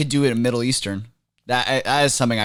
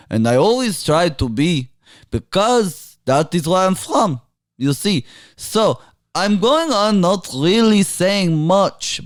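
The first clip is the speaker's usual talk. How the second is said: put-on Middle Eastern accent; 2 semitones higher than usual. Guest doing a requested Middle Eastern accent for the host. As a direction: play a pompous Middle Eastern dignitary.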